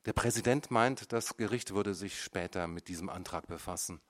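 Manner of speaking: ordinary delivery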